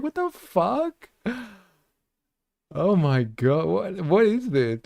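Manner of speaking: high and slow, stunned; stunned disbelief. Real, not acted. Streamer floored by the clip, high stunned disbelief. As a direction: gasp it out, floored. Reacting to explicit book quote.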